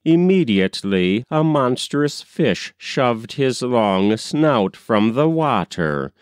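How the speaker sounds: ordinary delivery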